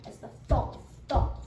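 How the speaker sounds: plain reading voice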